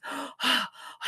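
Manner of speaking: acted gasp after a long dive; gasp for breath. Duck surfaces from a dive, gasping. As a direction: gulp air as if just surfacing. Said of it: "he was taking his deep breath".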